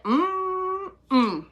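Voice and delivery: high, long-held hummed no; disapproving "mm-mm". Retold or imitated. Medium mimicking spirit to sitter, high disapproving hum. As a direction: hum high, then drop short. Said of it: "And he's like".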